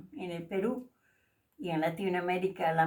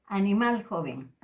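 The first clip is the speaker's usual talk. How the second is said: normal talking voice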